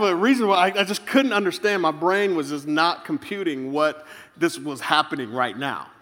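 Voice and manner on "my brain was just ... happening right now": ordinary talk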